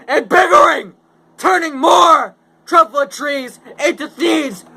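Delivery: high, loud defiant shouting; angry yell. Acted. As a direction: yell back at the nagging Lorax. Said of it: "I yelled at the Lorax".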